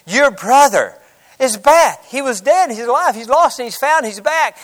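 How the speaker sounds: excited servant. Retold or imitated. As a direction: blurt it out like thrilled gossip. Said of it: "the servant comes out excited".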